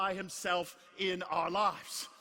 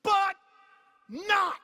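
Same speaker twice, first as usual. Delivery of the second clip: triumphant shout; 11 semitones higher, 9 dB louder than usual. Real, not acted. Triumphant climax of sermon.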